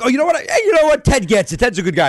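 high, loud enthusiasm; mock concertgoer talking the star up; retold won-over fan. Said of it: "and then you're like".

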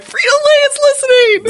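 high, loud excited squeal. Retold or imitated. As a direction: squeal it out in delight. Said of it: "I'm like".

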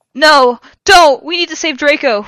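high, loud desperate plea; begging. A narrator's reading. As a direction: cry out, pleading for help. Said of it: "we begged".

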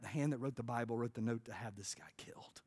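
hushed, breathy whisper for weight; a solemn stage whisper. Real, not acted. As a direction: lean in and whisper it. Dramatic point about David's sin.

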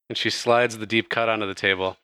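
ordinary talk, nothing marked

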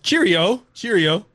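chirpy mock British farewell; mock British cheerio. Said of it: "they did accents".

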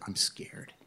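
frightened whisper, retold; imitating tearful friend. Dying man confessing fear to his friend, whispered. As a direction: whisper it like a scared, sick man. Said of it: "he started crying".

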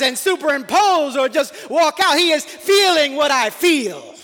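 high, passionate sermon voice; fervent preaching. Acted. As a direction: preach it out, rising with conviction. Preaching at full force.

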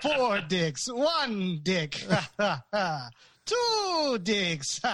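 overacted Count counting, with laughter; wild overacting. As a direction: ham up the counting vampire. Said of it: "Can you do a, an exaggerated ex-, uh, impression for me?".

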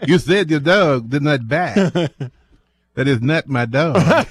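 slow, laughing mock French Clouseau voice; mock French accent. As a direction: ham up the French inspector, cracking up. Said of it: "Rednecks doing French accents".

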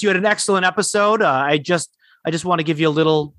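ordinary talk

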